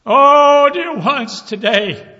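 high, shouted exhortation; preaching. Acted. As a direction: preach at full voice to the congregation. Preaching at full force.

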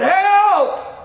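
loud, high drawn-out yell; screamed cry for help. Retold or imitated. A man in a phone booth screaming for help, desperate yell. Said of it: "He was screaming, he was crying out".

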